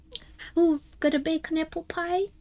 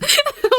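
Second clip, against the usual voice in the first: sudden high laugh; high burst of laughter; 13 semitones higher, 8 dB louder than usual. Real, not acted. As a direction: crack up loudly.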